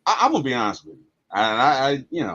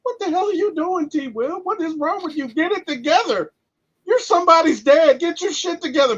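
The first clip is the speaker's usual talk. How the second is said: higher-pitched, exasperated self-scolding; incredulous inner rant, pitched up; scolding himself; 12 semitones higher than usual. Retold or imitated. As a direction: chew yourself out for tearing up at a movie. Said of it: "I'm sitting up here thinking".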